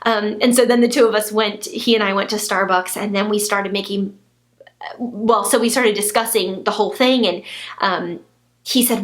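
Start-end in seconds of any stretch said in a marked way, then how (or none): none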